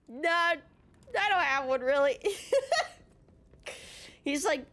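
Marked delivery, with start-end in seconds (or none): 0.1-2.3 higher voice tipping into laughter; giggly insistence, breaking up at the end; laughing. Real, not acted. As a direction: barely hold the laugh back. Laughs through her retold answer.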